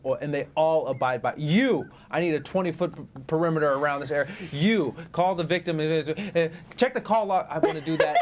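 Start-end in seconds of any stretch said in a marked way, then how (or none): 1.4-7.5 confident police chief. Acted. Playing chief ordering everyone around a crime scene, confidently. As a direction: take charge like a movie police chief. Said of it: "and speak so confidently".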